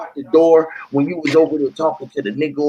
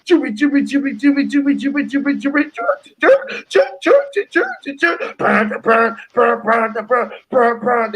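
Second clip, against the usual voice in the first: high chirpy scratch sounds; record scratch noises; 6 semitones higher than usual. Retold or imitated. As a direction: scratch the record with your mouth. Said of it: "And he scratched it".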